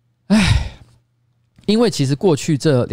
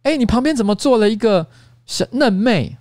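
higher, teasing gasp of surprise, imitating a staffer; mock amazed; 10 semitones higher, slower than usual. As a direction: play up the staffer's fake shock. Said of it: "然后关关吧就说".

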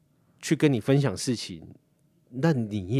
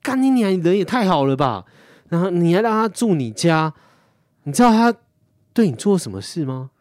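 high, loud retold outburst; imitating a friend cursing in outrage; outraged friend; 8 semitones higher, 8 dB louder than usual. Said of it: "他说：". (模仿朋友愤怒地大骂)

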